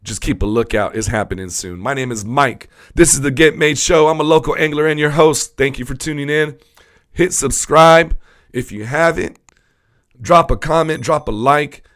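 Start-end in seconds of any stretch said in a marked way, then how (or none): 1.8-11.8 loud, high announcer sign-off; energetic podcast host sign-off; show host outro. Acted.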